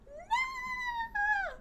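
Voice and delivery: long, very high-pitched thin wail; high squealed, stretched-out no; mock wailing no. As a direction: draw out a tiny high 'no' in mock despair. Mock despair at lost internet.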